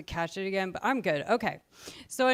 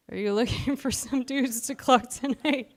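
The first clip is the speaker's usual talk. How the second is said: laughing through the line; 4 semitones higher than usual. Acted. Chicken-pun punchline, laughing.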